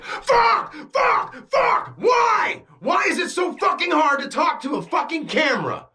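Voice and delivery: high crying shout, full of rage; frustrated screaming. Retold or imitated. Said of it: "I literally was like".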